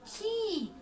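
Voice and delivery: "खी" with a long drawn-out ee; forceful, stretched vowel. Shown as a demonstration. Teacher asking pupils to say it along, stretched and forceful. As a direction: push out "खी" and hold its ee. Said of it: "जोर से बोलना है इसे खींच कर".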